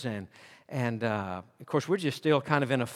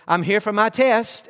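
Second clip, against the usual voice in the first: higher, louder, bubbly mom voice; perky mom; 6 semitones higher, 6 dB louder than usual. Retold or imitated. Mom checking in at the counter, perky and bright. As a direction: sound cheerful and chirpy.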